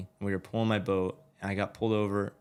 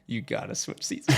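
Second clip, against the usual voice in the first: urgent hush; 5 dB quieter than usual. Retold or imitated. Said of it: "I'm like".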